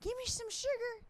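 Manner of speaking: high, put-on old granny voice; grandma voice. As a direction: croon it like a clingy old grandma. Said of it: "she's roll up with a rocker".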